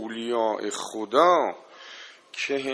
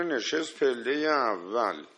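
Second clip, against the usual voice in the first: usual speaking voice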